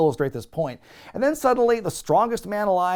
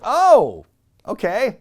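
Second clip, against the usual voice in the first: high, loud surprised oh; mock surprise; 7 semitones higher, 8 dB louder, slower than usual. Fan reacting to odd line, mock surprised. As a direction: fake being caught off guard.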